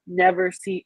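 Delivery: usual speaking voice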